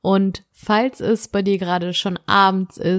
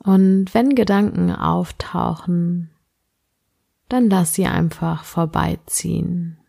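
sleep meditation; slower than usual. Acted. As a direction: guide the listener gently into sleep.

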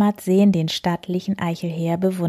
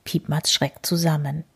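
ordinary delivery

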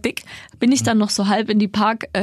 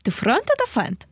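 put-on higher Russian accent movie quote; 4 semitones higher than usual. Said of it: "Traumschiff Surprise".

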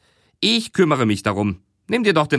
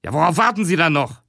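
higher, fast impatient snort; snorting boss; 5 semitones higher, faster than usual. Acted. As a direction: shove it out high and quick. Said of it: "Schnaubte Wert".

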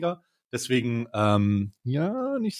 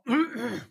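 short high hacking cough; coughing to clear the throat; coughing fit; 10 semitones higher, 5 dB quieter than usual. Real, not acted. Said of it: "ich hab mich verschluckt".

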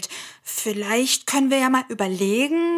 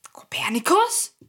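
questioning call; 5 semitones higher than usual. Acted. Calling again, no answer.

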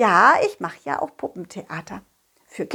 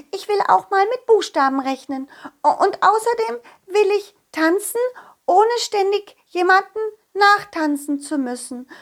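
squeaky little fairy, high and eager; an excited little fairy reading her list; high fairy child voice; 9 semitones higher than usual. Acted. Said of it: "Und dann legte sie los".

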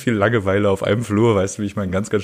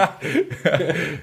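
high burst of laughter; 6 semitones higher, 4 dB quieter than usual. Real, not acted. Laughing at insult.

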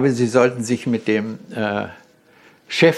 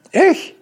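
one word, much higher and louder; proud answer; 6 dB louder than usual. Real, not acted. Asked who made the design, answering proudly: emphatic. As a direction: claim it outright.

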